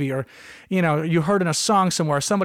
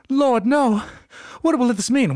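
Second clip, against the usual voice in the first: higher, louder hammed-up dismay; mock parental anguish; 7 semitones higher, 4 dB louder, slower than usual. Mother hearing her son's humor is removed, hammed-up anguish. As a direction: overplay the horrified parent. Parent reacting to doctor's news.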